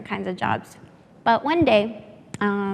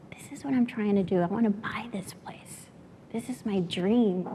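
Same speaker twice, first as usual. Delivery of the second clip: whispered, choked-up quote; emotional whisper; 7 dB quieter than usual. Real, not acted. Telling of father's dream, whispered with real emotion. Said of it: "sorry, I'm getting emotional".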